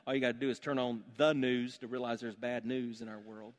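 normal talking voice

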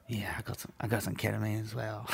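hushed, almost voiceless admission; whispered confession. Retold or imitated. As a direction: let it slip out in a guilty whisper. Sheepishly admitting more drugs to cop.

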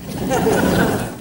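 breathy chuckle after the punchline; soft laugh. Real, not acted. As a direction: laugh lightly.

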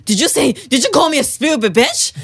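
high-pitched affronted challenge; confrontational demand. Retold or imitated. Retelling going back to confront him, affronted. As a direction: turn back and demand he own up.

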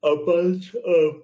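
words stretched out by a yawn. Real, not acted. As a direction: say it mid-yawn, slow and drawn out. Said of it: "just in case you wanna edit out my yawn".

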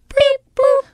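put-on tiny high toddler chirp; high, cute two-note chirp; little girl's beep boop. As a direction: squeak it out like a small child requesting a song. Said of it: "she goes".

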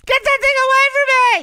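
high shrieking panic; panicked shriek. Retold or imitated. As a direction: shriek it like a scared wife. Wife scared by pelicans.

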